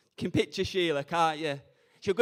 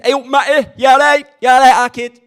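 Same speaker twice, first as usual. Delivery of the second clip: put-on Leeds accent; 5 semitones higher, 14 dB louder than usual. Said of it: "if you're from Leeds".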